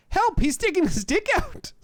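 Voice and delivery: put-on loud, very high boy's yell; squealing kid calling for help; child's shout. A narrator's reading. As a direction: raise the alarm at full pitch. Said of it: "the child shouts at the windows".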